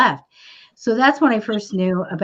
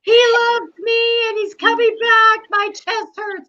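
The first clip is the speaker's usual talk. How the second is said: imitating lovelorn clients; 12 semitones higher than usual. Client insists to the psychic he's coming back, hammed-up imitation. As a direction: draw it out in a high mock lament. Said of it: "How many people tell me that?".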